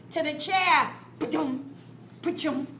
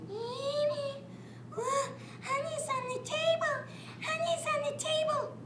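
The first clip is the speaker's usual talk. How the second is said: higher, hushed little bear; whisper-ish high voice of a hungry cub; higher soft bear cub voice; 9 semitones higher, 7 dB quieter than usual. Acted. Bear spots honey on table.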